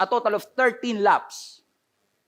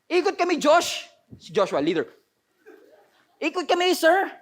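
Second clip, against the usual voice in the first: higher, eager soldier voice; soldier reporting; 6 semitones higher than usual. Retold or imitated. Israelite soldier telling Joshua they will circle, lightly voiced eager. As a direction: report to the leader like a keen recruit.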